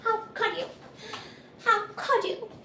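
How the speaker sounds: high, hammed-up wounded cat voice; a cat pretending to be deeply hurt; mock offended cat. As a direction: play the cat, squeaky and aghast. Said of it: "He's like".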